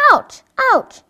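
high pitched mock cry of pain; girl waking with stomach pain, crying ouch. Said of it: "cried Joy".

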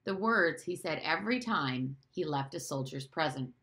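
ordinary delivery

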